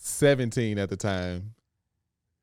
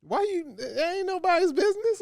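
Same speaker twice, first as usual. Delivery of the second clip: mock indignant. Real, not acted. Dodging a cheeky question.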